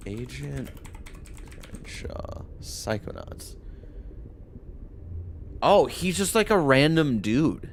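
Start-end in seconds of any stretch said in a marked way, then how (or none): 0.1-3.6 soft unvoiced whisper. Real, not acted. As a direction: breathe the names out quietly. Streamer reads credits softly.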